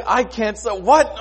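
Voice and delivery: high, loud mock protest; sleepless king's complaint. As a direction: complain over the racket outside. Acts out sleepless king in racket.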